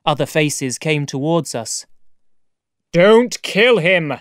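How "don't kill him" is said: loud, raised, drawn-out plea; urgent commanding cry; cried out. Acted. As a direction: shout to stop them, stretching the words. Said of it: "cried Moreau".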